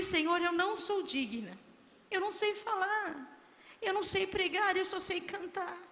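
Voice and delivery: high-pitched, earnest pleading; humble protest. Retold or imitated. Retelling her humble protest to God. (protesto humilde em tom agudo)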